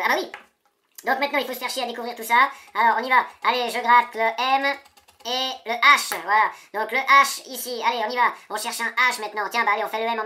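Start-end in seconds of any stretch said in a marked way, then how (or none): none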